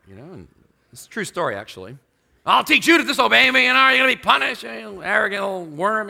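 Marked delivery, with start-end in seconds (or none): 2.4-6.1 high, loud mock rage at a child; furious mother yelling. Mother yelling at disobedient daughter, loud and high. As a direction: scream it like an enraged mother. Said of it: "starts yelling at her".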